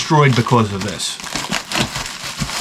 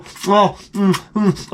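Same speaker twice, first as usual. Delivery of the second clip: sucking greasy fingers; fingers in mouth; slower than usual. Acted. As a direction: hum through sucked fingers. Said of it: "I'm sucking on my fingers".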